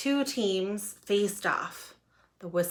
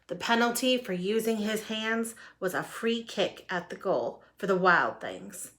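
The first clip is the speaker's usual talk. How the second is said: plain reading voice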